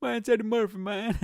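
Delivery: high comic celebrity impression; rough attempt at a star's voice; Eddie Murphy. Shown as a demonstration. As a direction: push the voice up into a comedian impression. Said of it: "If I could do impressions".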